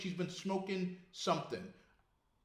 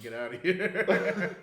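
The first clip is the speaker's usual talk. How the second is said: mock teary plea; much slower than usual. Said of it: "expecting them to start crying and say".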